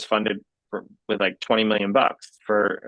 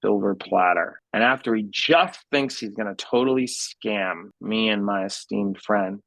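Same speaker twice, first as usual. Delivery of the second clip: ordinary talk, nothing marked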